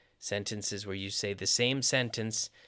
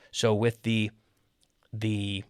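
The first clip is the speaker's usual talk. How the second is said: ordinary talk, nothing marked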